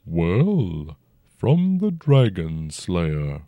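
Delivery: even, unhurried dragon voice; calm and level, an old dragon. A narrator's reading. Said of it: "Its voice stayed even".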